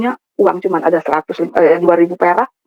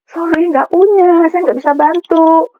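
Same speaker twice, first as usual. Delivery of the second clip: high, drawn-out voice of a woman refusing; slow, lifted mimicry of a refusal; imitated refusal; 7 semitones higher, 3 dB louder, slower than usual. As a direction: stretch it out high as an apologetic brush-off.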